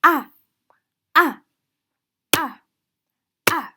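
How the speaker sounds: fourth-tone "Ah", abrupt and final; sharp falling tone. Shown as a demonstration. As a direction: drop each "Ah" like a thud. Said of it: "sudden fall from the fifth line to the first line".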